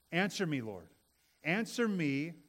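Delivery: loud earnest plea; pleading prayer. Acted. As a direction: beg God to answer. Elijah pleads with God.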